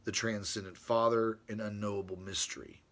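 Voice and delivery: reading his letter to Brother Ron aloud, formally. Acted. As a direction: read it like a solemn treatise. Reads from his page.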